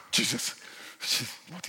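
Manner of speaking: gasped, breathless whisper of the name; frightened gasp. Retold or imitated. Startled churchgoer whispering the name in fright. Christians frightened at movie swearing.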